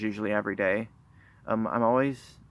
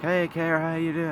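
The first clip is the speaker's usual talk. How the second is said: imitating a relative's greeting, pitched higher; lightly voiced relative saying hello, higher up; relative greeting her; 6 semitones higher than usual.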